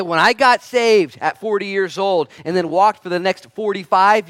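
high, loud, slow declaration; bold old warrior. Acted. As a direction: act out a defiant veteran making his case. Acts out Caleb's bold reply.

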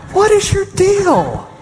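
high, laughing mock look from the elders; bemused elders. Calm elders staring at the panicking pastor, high and laughing. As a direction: voice the elders' puzzled stare with a laugh. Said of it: "they're all looking at me like".

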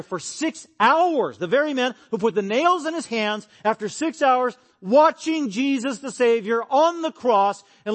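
high, loud, slow impassioned preaching; preaching at full force. Acted. Pastor preaching the soldiers at the cross, impassioned full-force preaching. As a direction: build the cross scene with fervor.

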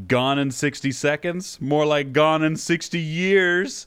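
slow, loud, drawn-out punchline; milk the terrible joke, slow and loud; joke punchline delivery. Acted. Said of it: "I'm gonna make a terrible joke".

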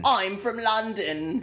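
slow, high put-on British accent; slow, high fake London accent. Shown as a demonstration. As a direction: stretch it out in a posh British accent. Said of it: "think you're luxurious if you're from London".

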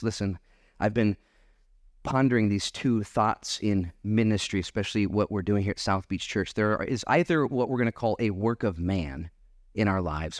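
ordinary talk, nothing marked

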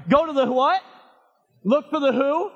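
loud rising question for the crowd to finish; call and response prompt. Shown as a demonstration. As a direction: toss the question out for them to answer.

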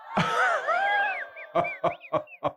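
shrill falsetto cackle at full voice; hammed-up squealing laugh; high shrieking laugh.

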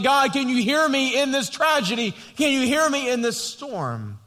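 high, loud plea to God; crying out. Retold or imitated. Pastor voicing David crying out in the storm, high and loud. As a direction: beg to be heard. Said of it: "he's crying out and saying".

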